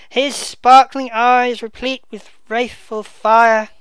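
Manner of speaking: slow, loud, declaiming verse; dramatic Shakespeare reading. Acted. Bedford mourning the dead king, slow loud declamation. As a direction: proclaim it like a grieving duke on stage. Reads Gloucester's praise aloud.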